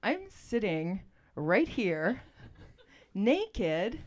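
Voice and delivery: quiet, hurt protest, then a slow laughing line; mock offended, slowing down; indignant retort. Model to rude classmate, quietly offended. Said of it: "I'm like".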